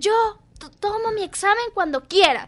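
imitated irritated teacher; agitated teacher. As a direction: throw it back with mock disdain. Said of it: "la profesora como alterada".

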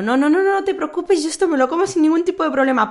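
people-pleaser voice. Retold or imitated. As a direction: reassure the waiter so as not to bother anyone. Appeasing waiter, people-pleasing.

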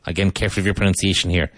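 usual speaking voice

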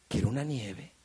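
low, hushed dawning craving; little boy wishing. Retold or imitated. Boy suddenly craves ice cream, hushed mock-childish wish. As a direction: quietly realize it like a kid.

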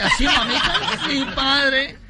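put-on high coy girlish voice; girl's voice. Young woman confessing to priest, high coy voice.

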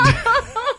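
high real laugh. Real, not acted. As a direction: laugh at the joke. Teacher laughs after joke.

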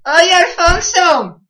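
a loud, slow call across the room; yelling for Alfonso to come over. Acted. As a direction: shout for Alfonso. Calling out to Alfonso.

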